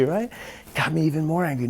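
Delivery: usual speaking voice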